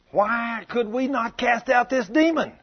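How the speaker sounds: high, drawn-out baffled question; puzzled disciples. Acted. Disciples come to Jesus by night, asking in bewilderment. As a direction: stretch it out in acted bewilderment.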